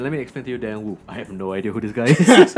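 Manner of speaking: ordinary delivery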